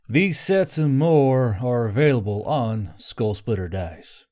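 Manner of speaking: ordinary delivery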